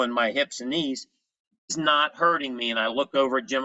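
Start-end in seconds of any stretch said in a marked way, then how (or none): none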